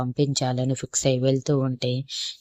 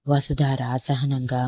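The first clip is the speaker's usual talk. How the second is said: usual speaking voice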